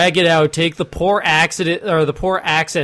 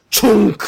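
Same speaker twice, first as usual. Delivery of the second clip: loud mock clunk of a giant switch; 5 semitones higher, 5 dB louder than usual. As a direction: slam the word like a lever. Imitating giant switch thrown.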